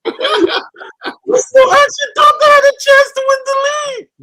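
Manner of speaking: words squeezed out high through laughter; can barely get it out for laughing; cracking up mid-sentence; laughing hard. Real, not acted.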